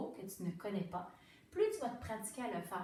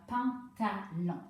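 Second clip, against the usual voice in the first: «pantalon» stretched slowly as one joined word; blended slowly; 4 dB louder, much slower than usual. Shown as a demonstration. Teacher to pupil, blending the syllables into «pantalon». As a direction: glue «pantalon» back together slowly. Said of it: "je colle le morceau plus rapidement".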